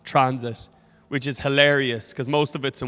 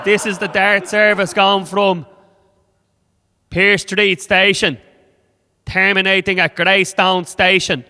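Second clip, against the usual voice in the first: loud, higher, "Dart service" run together as a statement; train driver announcement; 4 semitones higher, 8 dB louder, slower than usual. Acted. Driver listing stops for passengers, loud halting announcement. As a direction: call "Dart service" firmly, then each stop separately.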